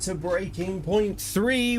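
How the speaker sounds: drawn-out presenter voice; announcer intro. Acted. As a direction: roll out the title like a host.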